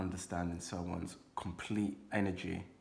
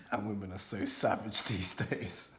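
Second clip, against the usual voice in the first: ordinary talk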